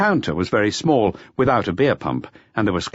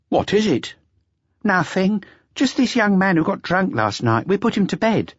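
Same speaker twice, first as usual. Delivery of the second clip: higher, brisk waitress; higher dismissive waitress; 12 semitones higher than usual. Acted. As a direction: brush off the question lightly. Waitress explains to customer.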